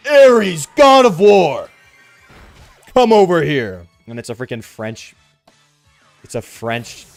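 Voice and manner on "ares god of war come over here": loud, booming dog call; grand announcer voice. Pretend. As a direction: call the dog like a war god. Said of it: "a freaking pug comes walking out".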